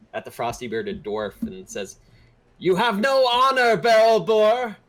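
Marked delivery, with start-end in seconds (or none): 2.6-4.8 loud, high, drawn-out shout; outraged yell, stretching the last words; yelling accusation. Acted. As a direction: hurl the insult across the dock. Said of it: "And the newcomer yells".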